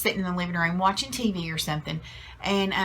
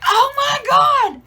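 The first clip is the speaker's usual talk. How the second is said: loud, high, drawn-out cry imitating an overwhelmed dad; hammed-up shout of total defeat; exasperated outburst; 10 dB louder, much slower than usual. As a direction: wail it at the top of your voice, slowly. Husband's exasperated outburst, acted out.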